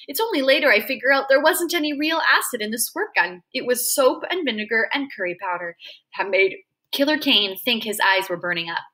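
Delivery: plain reading voice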